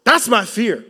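loud, rising sermon delivery; preaching at full force. Acted. Preacher warning the congregation, loud and urgent. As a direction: press the fear home to the church.

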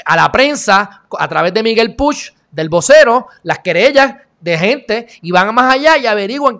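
high, loud, indignant-sounding tirade; forceful rant with heavy emphasis; emphatic denunciation. Real, not acted. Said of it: "dándole un poquito de énfasis".

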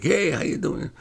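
high-pitched gushing hello; mock effusive greeting. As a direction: imitate an over-friendly greeting. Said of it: "Eddie's very private. He didn't really".

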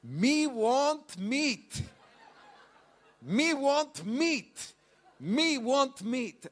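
slow, high, laughing broken English; broken English practice. Retold or imitated. Preacher mimics mum drilling broken English, high and slow. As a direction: mum rehearsing her few English words. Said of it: "Mum practiced it".

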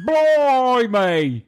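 high, exaggerated cockney exclamation; hammed-up cockney. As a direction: squawk it in mock cockney. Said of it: "with a cockney accent".

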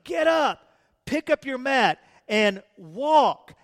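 Jesus urging the lame man with intensity; intense command. Acted. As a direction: get up, pick up your mat, and walk with fire. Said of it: "He had intensity about the way".

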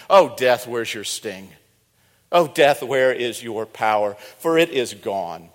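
Oh death shouted out loud; triumphant preaching. Retold or imitated. Paul preaching his great sermon, loud and triumphant. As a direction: thunder it like Paul in the pulpit. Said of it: "preach such a great sermon".

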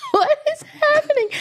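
high, laughing what-is-going-on; laughing disbelief. Retold or imitated. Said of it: "I'm just smiling at him like".

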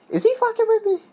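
high incredulous voice of Thomas. Retold or imitated. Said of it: "He was like".

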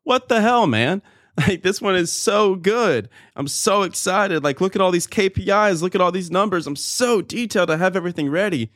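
high, eager, indignant voice; excited protest. Retold or imitated. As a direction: gush about it like a proud kid. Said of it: "I'm like".

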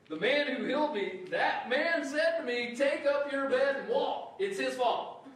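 high, loud whiny blame-shifting; healed man passing blame. Retold or imitated. Healed man to the leaders, shifting blame in a high loud whine. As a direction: pin it on the other guy, high and loud.

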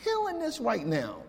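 high, excited shout-out to a friend; hyped praise. Retold or imitated. Praising a friend's models, high and excited. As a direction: cheer him on like he's crushing it. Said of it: "I'm like".

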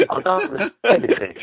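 high, loud laughing words. Real, not acted. Laughing at the joke.

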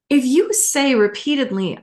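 ordinary delivery

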